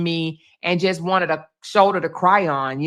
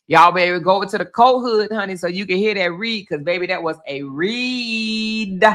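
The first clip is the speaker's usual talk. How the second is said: ordinary delivery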